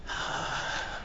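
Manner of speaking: long hushed exhale; soft sigh. Real, not acted. Preacher pausing after resurrection story, soft sigh. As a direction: let out a quiet sigh.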